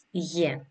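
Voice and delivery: γε said softly as "ye"; soft, isolated γε, a y glide into e; letter sound. Shown as a demonstration. Teacher repeating the pronunciation, γε demonstrated as a soft "ye". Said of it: "η προφορά του είναι".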